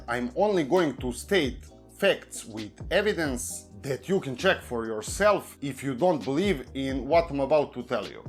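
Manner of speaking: normal talking voice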